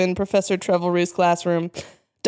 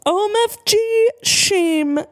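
very high, drawn-out squeal; mock shriek; 12 semitones higher, slower than usual. A narrator's reading. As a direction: shriek it like an overexcited fangirl. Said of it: "I shrieked".

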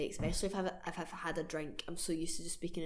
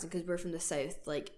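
normal talking voice

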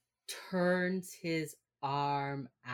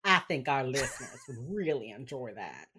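put-on Texas accent. Mimicking co-host's request back, Texas accent. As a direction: agree in a broad Texan twang. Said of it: "in your Texas accent".

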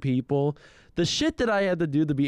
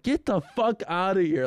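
higher, mild mock yell; retold yelling; 6 semitones higher than usual. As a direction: act out yelling someone away, not at full volume. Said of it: "both of us are yelling that".

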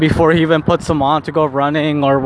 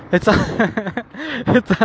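laughing; 5 semitones higher, 4 dB quieter than usual. Real, not acted. Laughing while retelling the quote.

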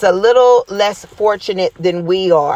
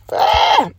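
surprised high yelp. Real, not acted. Finds the scripture suddenly.

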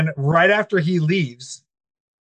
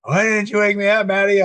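higher-pitched mock grievance; retold indignant whine, pitched up; imitated mad complaint; 5 semitones higher than usual. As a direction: mimic a put-out friend complaining. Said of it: "then he was mad".

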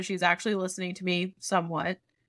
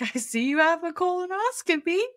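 clueless doctor; 8 semitones higher than usual. Retold or imitated.

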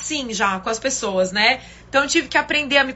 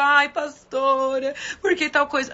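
whiny church member; slower than usual. Retold or imitated. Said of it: "a pessoa".

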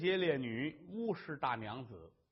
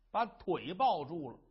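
plain reading voice

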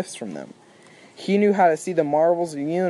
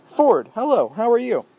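high, bright surprised hello; startled greeting; 7 semitones higher, 4 dB louder than usual. Acted. Arthur in the mud, startled to see Ford above him. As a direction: caught off guard greeting a friend.

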